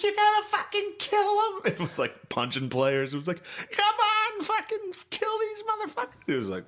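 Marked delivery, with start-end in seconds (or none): 0.0-1.5 high, strained imitation of a screaming coach; freaked-out coach. Player imitating his coach's halftime meltdown, high mock yell. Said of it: "he just freaked out". 3.4-6.3 high, strained imitation of a screaming coach; freaked-out coach. Player imitating his coach's halftime meltdown, high mock yell. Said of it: "he just freaked out".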